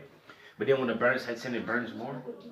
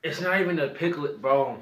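excited outburst; 4 semitones higher, 6 dB louder than usual. Real, not acted. Reacting to spicy pickle.

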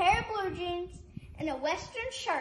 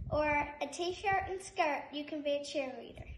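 ordinary talk, nothing marked

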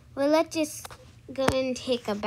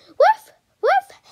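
very high, loud yappy barks; child voicing her toy puppy, squeaky barks; puppy woof; 10 dB louder than usual. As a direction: yap like a tiny excited puppy.

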